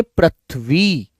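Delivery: पृथ्वी said very slowly, each sound clear; slow dictation. Shown as a demonstration. Teacher dictating पृथ्वी to pupils, very slow. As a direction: draw out पृथ्वी for the class to write. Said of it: "कैसे लिखेंगे?".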